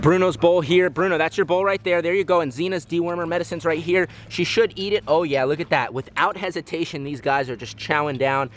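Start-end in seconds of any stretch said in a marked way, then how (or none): none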